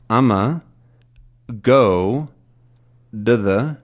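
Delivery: "I'ma", "go", "to the" as separate reduced beats; smushed chunks. Shown as a demonstration. Teacher breaking the sentence into chunks, smushed word by word. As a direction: squash "I'm gonna" to "I'ma", pause, loud "go", quick "to the". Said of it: "smush this all down together".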